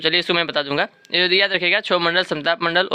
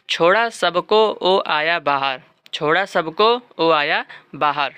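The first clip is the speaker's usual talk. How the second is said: slow mnemonic recital; slower than usual. Shown as a demonstration. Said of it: "तो इसके trick भी है".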